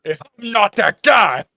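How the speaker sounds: loud, high, drawn-out mock shout; mimicking outburst. Co-host mimics the viral clip's outburst, mock shouting. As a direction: imitate the furious guy, hammed-up and slow. Mimicking the clip just played.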